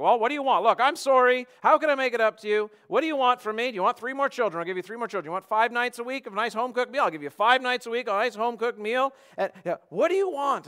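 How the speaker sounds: high, loud, exasperated pleading; frustrated wife. Acted. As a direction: throw out offers in loud exasperation. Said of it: "she just gets frustrated with her husband".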